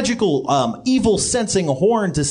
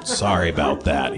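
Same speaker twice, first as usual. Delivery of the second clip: deep Yeti voice. Acted.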